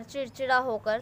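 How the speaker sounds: ordinary talk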